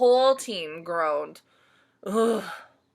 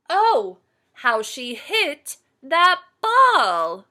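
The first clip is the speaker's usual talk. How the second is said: excited; 6 semitones higher, 10 dB louder, much slower than usual. A narrator's reading. Narrator exclaims big hit.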